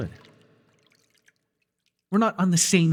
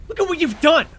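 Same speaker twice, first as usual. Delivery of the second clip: much higher, louder furious outburst; furious shout; 5 dB louder than usual. Acted. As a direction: yell at him in outrage. Confronting the rival mentor.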